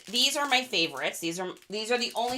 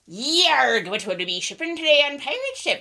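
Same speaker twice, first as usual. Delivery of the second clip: mock pirate; 6 semitones higher, 3 dB louder than usual.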